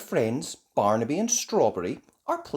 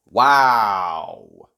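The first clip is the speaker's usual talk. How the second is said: loud, drawn-out wow; marvel, stretching the word; surprised gasp; 5 semitones lower, 10 dB louder than usual. A narrator's reading. Children finding the broken robot, loud amazement. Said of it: "The children gasp, surprised".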